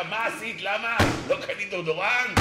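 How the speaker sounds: high, loud accusing question; hammed-up husband blaming his wife; mock angry husband. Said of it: "והוא שואל אותה".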